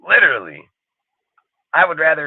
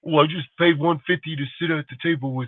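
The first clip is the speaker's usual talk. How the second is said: higher, softer mock voice of a fan who paid; mock smug fan; 6 semitones higher, 5 dB quieter than usual. Mocking the fan who paid one fifty for a seat, higher and softer. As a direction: play the sucker bragging about his dinner.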